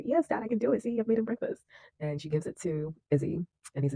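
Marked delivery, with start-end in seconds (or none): 0.0-1.6 put-on high-pitched little girl voice; young daughter. Daughter proves to Dad she can, high girlish voice. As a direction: pitch it well up, imitating a daughter.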